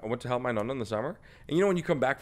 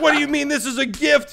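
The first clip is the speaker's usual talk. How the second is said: much higher and louder, puzzled grandma; mock nonna; 8 dB louder than usual. Nonna to grandson over the skinned lamb, high loud mock puzzlement. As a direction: play the baffled nonna handing over the gift. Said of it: "she'd be like".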